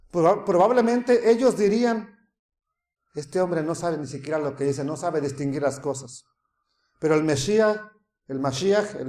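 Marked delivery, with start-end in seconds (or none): 3.1-6.2 low, softer aside of doubters; muttered scorn. Retold or imitated. Scoffers murmuring about the Messiah, low and muttered. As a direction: murmur it like a sceptical onlooker. Imagined mockers murmuring among themselves.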